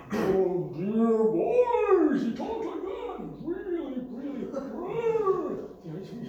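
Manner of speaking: high, slow, drawn-out preacher voice; impression of Al Martin. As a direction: stretch every word like an old pulpit orator. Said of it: "an impersonation of him because he had a great voice".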